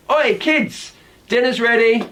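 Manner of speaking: high call; calling the kids. Shown as a demonstration. Parent calls the kids to dinner. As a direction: call them to the table. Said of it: "my example sentence".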